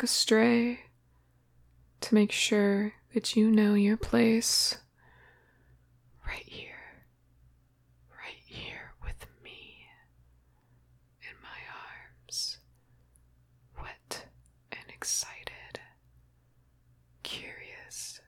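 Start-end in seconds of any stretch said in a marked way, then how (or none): 6.3-18.2 very soft close whisper. Acted. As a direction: whisper it right into the ear. Intimate ASMR roleplay whisper.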